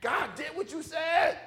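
high-pitched, amazed outburst; incredulous servant. Acted. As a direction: squeal it in comic disbelief. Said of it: "his servant would have been like".

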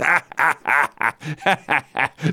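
real belly laugh in a long string. Real, not acted. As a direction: burst out laughing at the trade. Mocking the Giants' trade.

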